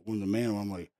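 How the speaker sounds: ordinary talk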